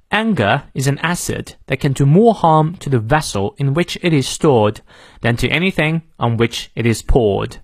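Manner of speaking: British English with silent r in "harm", "stored", "poured"; British model sentence for learners; British pronunciation. Shown as a demonstration. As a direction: read it clearly in a non-rhotic British accent. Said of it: "英式发音元音后的R不念".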